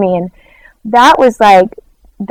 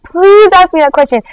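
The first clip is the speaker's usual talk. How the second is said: begging; 9 semitones higher, 3 dB louder than usual. Retold or imitated. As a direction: stretch the "please", imploring. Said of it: "begging me".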